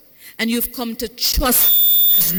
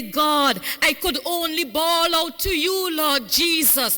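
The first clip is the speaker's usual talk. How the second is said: impassioned prayer; 4 semitones higher than usual. Acted. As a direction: cry it out to the Lord, rising. Preacher voicing a bawling prayer.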